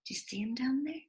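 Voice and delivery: teacher quietly asking preschoolers to look; hushed aside. Real, not acted. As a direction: ask it hushed, pointing at the page. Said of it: "aside to children".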